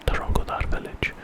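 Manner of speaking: usual speaking voice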